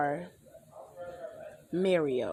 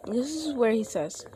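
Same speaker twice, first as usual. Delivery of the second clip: usual speaking voice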